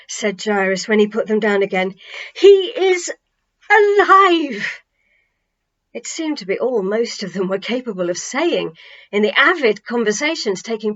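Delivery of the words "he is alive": high, loud, bursting with joy; joyful exclamation. Acted. Jairus telling the crowd he is alive, elated. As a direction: let the news burst out.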